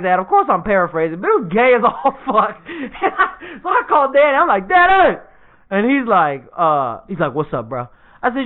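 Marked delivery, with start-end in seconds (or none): 4.7-5.2 loud, high summons; sharp mock-scolding call. Calls brother over to scold. 7.3-7.9 low, soft, casual reply; laid-back acted mumble; nonchalant little brother.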